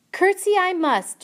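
loud high eager shout. A narrator's reading. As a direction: shout it out proudly. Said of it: "shouted Mig".

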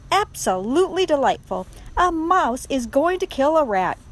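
high, loud theatrical delight; gleeful gloating. A narrator's reading. Rat watching mouse threaten rat, gleeful. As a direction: relish the coming spectacle.

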